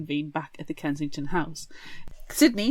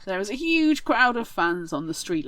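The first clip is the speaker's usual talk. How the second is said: ordinary talk, nothing marked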